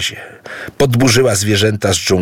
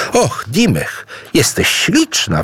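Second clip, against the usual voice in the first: high, delighted exclamation; exclaiming; 9 semitones higher than usual. Acted. Idu admires his transformed bride, high and delighted. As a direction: gasp it out in admiration. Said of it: "wykrzyknął Idu".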